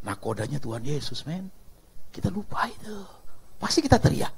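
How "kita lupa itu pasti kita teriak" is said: hushed, breathy whisper to the hall. Acted. Preacher whispers to the congregation a secret reminder.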